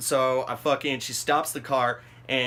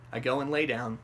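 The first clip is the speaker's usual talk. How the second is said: ordinary delivery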